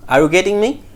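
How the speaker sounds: ordinary talk, nothing marked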